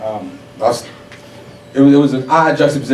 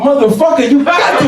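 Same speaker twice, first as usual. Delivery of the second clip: very high-pitched, incredulous outburst; exasperated anger; 12 semitones higher than usual. Acted. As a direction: blow up at being asked once more. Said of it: "why does it make you angry".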